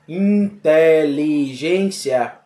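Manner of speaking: syllable by syllable. Shown as a demonstration. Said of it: "Então veja, quantas sílabas".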